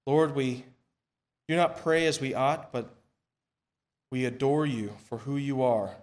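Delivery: normal talking voice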